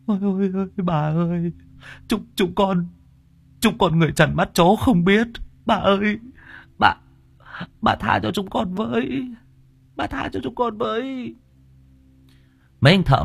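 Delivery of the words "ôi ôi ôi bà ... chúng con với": high tearful wailing, acted in full; whimpering plea. A narrator's reading. Terrified worker to the possessing spirit, whimpering plea. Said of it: "Thằng Long lúc này thì mếu máo".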